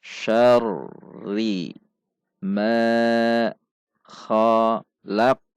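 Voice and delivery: dieja lambat per potongan, dengung panjang; panjang pendek bacaan. Shown as a demonstration. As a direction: perlihatkan panjang pendek tiap kata dengan jeda. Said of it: "perhatikan panjang dan pendek bacaan". (bacaan terputus-putus untuk murid)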